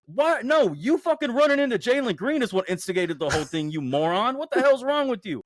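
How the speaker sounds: yelling at a player through the screen; heated outburst. Real, not acted. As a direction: tear into him, furious and incredulous. Angry rant at DeRozan.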